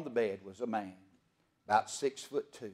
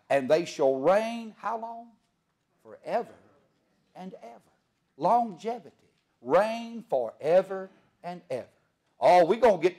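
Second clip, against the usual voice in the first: preaching; 6 semitones higher, 4 dB louder than usual. Acted. Preaching, building on reign forever.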